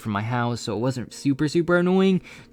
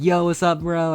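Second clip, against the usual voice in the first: higher-pitched, upbeat re-enacted hello; happy greeting; 6 semitones higher, 3 dB louder than usual. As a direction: greet your buddy, pleased to see him. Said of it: "super happy to see him".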